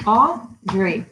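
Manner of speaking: "Audrey" in two separate beats; clapped-out syllables. Shown as a demonstration. Teacher clapping out her name for children, "Audrey" syllable by syllable. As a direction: split "Audrey" into Au and drey. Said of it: "We can clap it out".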